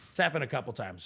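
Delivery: ordinary talk, nothing marked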